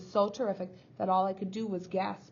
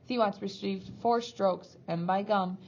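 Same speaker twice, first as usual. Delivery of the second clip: ordinary delivery, nothing marked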